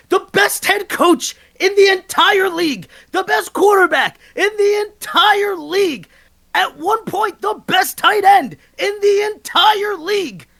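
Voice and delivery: real heated sports rant. Real, not acted. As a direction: build the case like a furious fan. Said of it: "all-time [name] rant right there".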